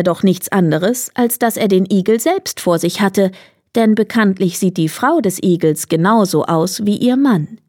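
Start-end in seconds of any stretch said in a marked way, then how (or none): none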